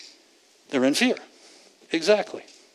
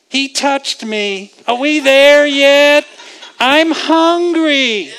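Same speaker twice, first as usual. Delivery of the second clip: put-on high, slow whiny child in the back seat; kids whining; 8 semitones higher, 5 dB louder, slower than usual. Child in the back seat whining to the driver. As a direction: whine it like a bored kid on a long drive.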